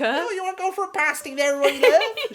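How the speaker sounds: very high, teasing Cornish lilt; mock Cornish accent. Needling a friend about pasties, mock Cornish accent. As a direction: goad them in cartoon Cornish. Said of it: "when the Cornish speak with their dialects".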